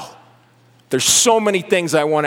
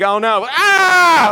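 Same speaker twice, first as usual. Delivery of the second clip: higher, louder, slow hammed-up shriek; mock terrified scream; 10 semitones higher, 6 dB louder, much slower than usual. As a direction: scream like a panicking human, played for laughs. Angels mocking frightened humans.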